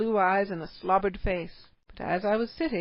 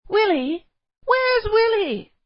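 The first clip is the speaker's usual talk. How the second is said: very high, loud, slow cry of alarm; alarmed shout; 13 semitones higher, 9 dB louder, slower than usual. A narrator's reading. Parents realise their son is missing, loud alarmed shout. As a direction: panic for the lost child. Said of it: "a sudden exclamation from both".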